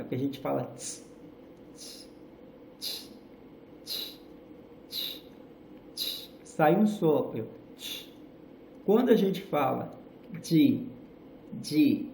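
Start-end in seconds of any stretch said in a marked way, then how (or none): 0.8-8.1 breathy tss puffs for the T sound; unvoiced tss. Shown as a demonstration. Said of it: "sai sem vibrar na garganta". 10.4-12.1 "de" with buzzing throat; voiced de. Shown as a demonstration. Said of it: "a garganta vibra".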